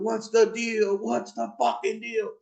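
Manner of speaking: high, held mock scream; high-pitched retold yell; imitated screaming. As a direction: imitate a high holler without full volume. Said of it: "he's screaming".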